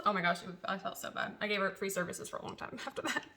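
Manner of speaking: ordinary delivery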